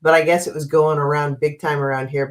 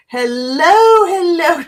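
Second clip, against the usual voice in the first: excited greeting; 12 semitones higher, 5 dB louder, slower than usual. Real, not acted. Greeting viewers joining.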